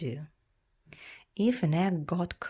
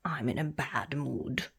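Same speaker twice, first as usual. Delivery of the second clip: lower, grumbling dragon; grumpy dragon; 4 semitones lower, 3 dB quieter than usual. Acted. Fire dragon announcing its mood, grumpy and low.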